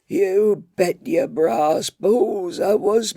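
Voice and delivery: put-on wag a finger like a cranky old tea lady; scolding old woman. Old tea lady scolding Daniel for whinging, high old voice. Gwynnie's long rant.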